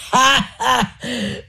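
high rowdy guffaw; roaring laughter. Acted. The monatti mock the fleeing enemies, a high roaring laugh. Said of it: "uno scroscio procelloso di risa".

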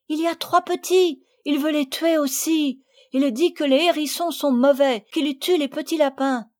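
high, loud, anxious explaining; urgent pleading. Acted. Madeleine explaining the hedgehogs to her mother. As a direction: explain in a rush, upset.

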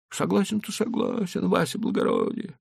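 put-on high, pleading old merchant. A narrator's reading. Leiba replies.

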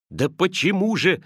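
nephew's frustrated outcry; cried out. A narrator's reading. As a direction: exclaim it as an urgent question. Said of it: "вскричал племянник".

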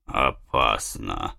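very low, slow growl. A narrator's reading. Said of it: "проворчал глухонемой испанец".

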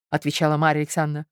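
ordinary delivery, nothing marked

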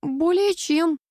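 high, strained reply; puffing. A narrator's reading. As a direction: puff it out while wriggling in tight clothes. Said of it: "пропыхтела я, извиваясь".

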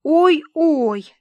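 high, loud "oh dear"; disapproving exclamation. A narrator's reading. Tomochka hears the dress's weight, exclaims disapprovingly. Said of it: "неодобрительно воскликнула Томочка".

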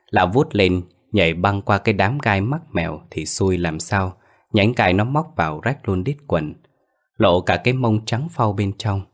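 ordinary delivery, nothing marked

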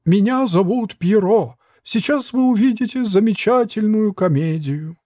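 squeaky sad puppet. A narrator's reading. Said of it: "Печальным тоном и писклявым голосом".

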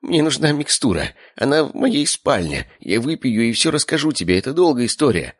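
high, plaintive uncle voice; pleading. A narrator's reading. Uncle asking brother for his medicine, pleading. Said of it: "затем умоляюще посмотрел на отца".

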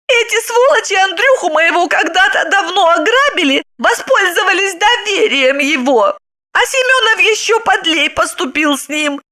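high, boastful, contemptuous; proud wife. Acted. Wife proudly tells how husband stole her, high boast. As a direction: boast about it. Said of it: "с гордостью сообщила она".

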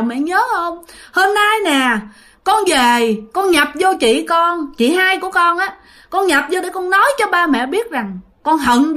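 high, pleading, accusing son; reproachful ghost child. Retold or imitated. Dead son's spirit reproaching his father, high pleading. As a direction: beg and blame at once, voice lifted high. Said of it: "trách móc rất là nhiều".

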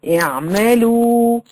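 اعملوا with the final oo held long and level; drawn-out long vowel. Shown as a demonstration. Showing the waw madd to students, vowel lengthened. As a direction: stretch the last waw of اعملوا. Example word with prolonged madd.

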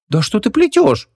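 high, incredulous exclamation; astonished disbelief; genuine surprise. A narrator's reading. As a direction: react as if told nonsense. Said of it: "всерьёз удивилась подружка".

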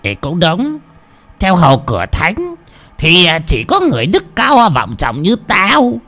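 high, pompous and condescending. Acted. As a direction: look down on him, puffed up. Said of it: "đoạn lên giọng". (lên giọng kẻ cả)

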